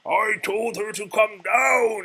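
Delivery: high, slow, shouting beast; roar. Acted. The beast roaring about Belle missing dinner. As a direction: roar it out in frustration. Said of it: "he roared".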